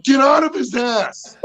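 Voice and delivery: high, slow, laughing shout; dog yell. Retold or imitated. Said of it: "I'm like".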